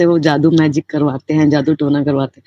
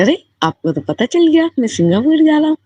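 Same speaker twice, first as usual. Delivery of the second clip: much higher, bright astonished reply; delighted surprise; 12 semitones higher than usual. Retold or imitated. Devotee telling Guruji he already knew about Singapore, delighted and surprised. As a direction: squeal it like a happy discovery.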